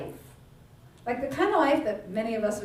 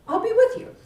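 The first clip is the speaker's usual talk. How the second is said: much higher and louder, lifting on with; emphatic promise; 12 semitones higher, 7 dB louder than usual. Acted. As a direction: repeat the promise with big emphasis. God repeats promise louder.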